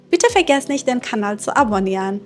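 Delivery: usual speaking voice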